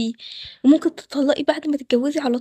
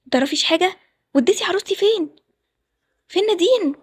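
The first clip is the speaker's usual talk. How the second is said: angry demand; 3 semitones higher than usual. Acted. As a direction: demand an answer, getting angrier each line. Groom demands his missing bride. (زعيق بغضب متصاعد)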